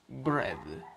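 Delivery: bread said "bred", short e; English bread. Shown as a demonstration. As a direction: say bread rhyming with red. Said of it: "lo pronunciamos bread". (bread pronunciado "bred")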